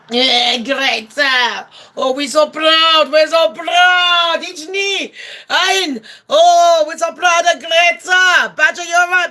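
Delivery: high, drawn-out hammed-up cheering; mock proud parents. Parents cheering Greta off to sea, mock proud shouting. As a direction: shout exaggerated pride from afar, stretching the words.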